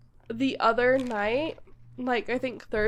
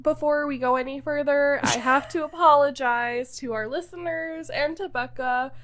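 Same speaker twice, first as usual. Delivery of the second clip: ordinary talk, nothing marked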